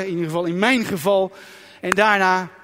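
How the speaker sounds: normal talking voice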